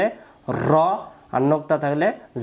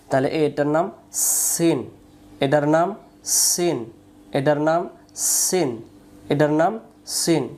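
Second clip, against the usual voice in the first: slow teacher repetition; slower than usual. Shown as a demonstration. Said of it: "teacher repeats slowly".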